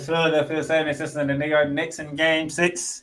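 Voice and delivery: booming public address announcer; arena announcer voice. Pretend. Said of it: "trying to sound like the O P A announcer of the Spectrum".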